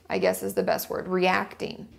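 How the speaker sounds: ordinary delivery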